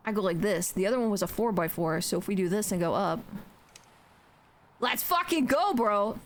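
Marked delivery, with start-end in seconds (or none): 4.8-6.2 higher-pitched hype shout-out; excited cheer. Real, not acted. Streamer celebrates own build to viewers, excited.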